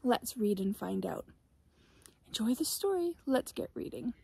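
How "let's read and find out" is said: ordinary delivery, nothing marked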